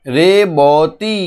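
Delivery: "রেবতী" very slow, রে-ব-তী; sounding out. Shown as a demonstration. Teacher reads what was written, "রেবতী" stretched by syllable. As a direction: draw out each syllable of "রেবতী". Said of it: "সেই রকমভাবে যদি আমরা উচ্চারণ".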